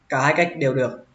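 ordinary talk, nothing marked

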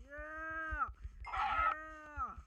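high loud cheer; excited shout. Real, not acted. Gamer cheering over the loot, loud whoop. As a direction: whoop it out in triumph.

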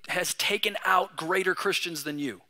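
ordinary talk, nothing marked

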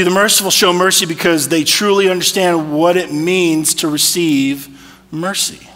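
normal talking voice

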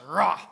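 mock lion roar, loud. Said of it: "that's my lion impression".

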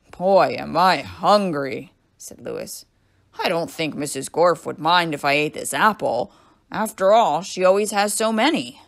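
loud, easygoing character voice; hearty yard teacher, hungry. A narrator's reading. Yard teacher eyeing an apple, hearty and hungry. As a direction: boom it out, cheerfully oblivious.

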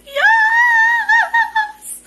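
high, loud cry of joy; excited shout. Retold or imitated. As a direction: shriek it out like a thrilled fan. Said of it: "and I'm just like".

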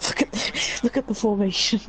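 breaking up with laughter mid-phrase; real laughing. Real, not acted. Gamer showing a friend the formation, laughing as they speak. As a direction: try to get it out through laughter.

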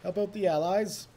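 put-on a light, vaguely British accent on a rally call; rally the troops in a hint of a British accent. Gamer rallying his units to help the allies, in a vaguely British accent. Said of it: "a vaguely British accent for that".

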